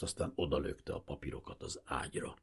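usual speaking voice

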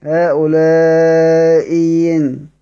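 هؤلاء ين with the hamza turned into a clear ya, drawn out; tajweed demo of ibdal to ya; pure ya. Shown as a demonstration. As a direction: recite هؤلاء ين slowly, a pure ya in place of the hamza. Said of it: "ياء مكسورة".

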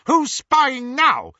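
high, loud, slow accusing shout; angry shout. Acted. Snape catches Petunia spying and shouts at her, high and loud. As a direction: bellow it at the intruder. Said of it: "he shouted".

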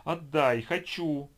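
put-on a spoiled child's shrill demand; childish demand. A narrator's reading.

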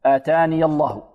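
usual speaking voice

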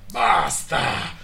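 husband yells at the mocking voices to stop; shout. A narrator's reading. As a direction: explode and cut them off. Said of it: "urlò lui".

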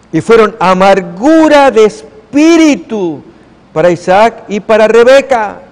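solemn pulpit read; preached scripture. Acted. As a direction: lean on each word of bitterness.

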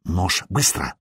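urgent low murmur; whisper. A narrator's reading. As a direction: whisper the demand so no one overhears. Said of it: "шепнула Цири". (торопливый тихий шёпот)

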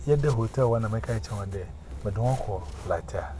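ordinary talk, nothing marked

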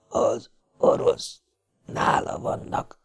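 slow, breathless whisper from a dying man; slow whisper, wounded and fading. A narrator's reading. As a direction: barely breathe the words out.

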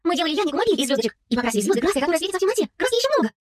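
put-on keen girl pitching an idea; enthusiastic. A narrator's reading. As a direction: tell it with mounting excitement. Girl eagerly explains her idea.

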